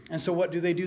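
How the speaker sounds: usual speaking voice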